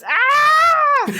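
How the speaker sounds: high, loud mock rat scream; hammed-up rodent shriek. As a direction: scream like a poked pet rat. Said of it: "I know they can scream".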